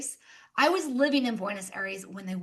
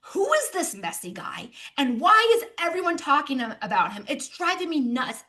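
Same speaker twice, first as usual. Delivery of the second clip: half joking exasperation; 3 semitones higher, 7 dB louder than usual. Retold or imitated. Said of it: "half jokingly, half exasperated".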